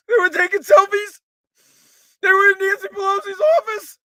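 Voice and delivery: mock outrage building to a shout; loud, high, hammed-up indignation; Hakeem Jeffries. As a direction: escalate the parody speech into shouting. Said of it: "his tone of voice, like".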